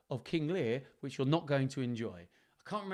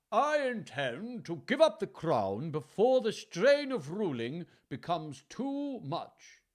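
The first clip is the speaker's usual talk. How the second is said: slow, higher, grand royal voice; aged royal king; 4 semitones higher, 5 dB louder, slower than usual. Acted. Aged king addresses his nobles, slow grand royal voice. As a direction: proclaim it in a drawn-out kingly voice.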